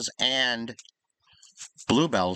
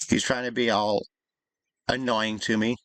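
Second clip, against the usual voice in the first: usual speaking voice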